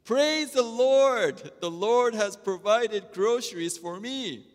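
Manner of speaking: high, loud, slow joyful cry on "Praise"; elated. Acted. Old lady finds groceries on her porch, elated and high. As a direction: burst out in overjoyed praise. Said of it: "she is so elated".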